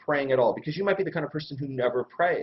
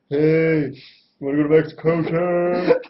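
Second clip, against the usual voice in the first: mock buddy on the phone; 7 dB louder, slower than usual. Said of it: "I don't talk like that".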